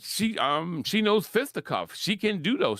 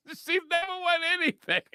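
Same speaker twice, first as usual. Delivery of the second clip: laughing through the words, high; high, tickled repeat; 14 semitones higher than usual. Real, not acted. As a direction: break up laughing as you say it. Repeats the line while laughing.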